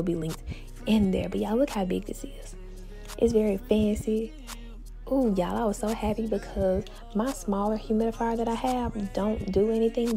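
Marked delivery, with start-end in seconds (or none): none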